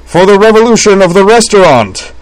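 high, very loud group cheer; ringing, full-voiced battle cry; rousing toast. A narrator's reading. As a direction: proclaim a grand toast. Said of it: "said together, raising their water glasses in a toast".

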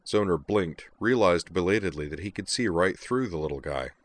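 plain reading voice

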